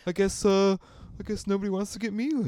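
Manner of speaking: high, whiny, put-upon grandma voice; guilt-trippy grandparent. Pretend. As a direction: play the martyr, fishing for pity. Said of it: "guilt-trippy grandparent".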